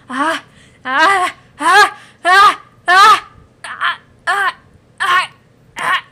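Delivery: series of loud, high wordless yells; repeated cries. Acted. Creature breaking down in agony, crying out repeatedly.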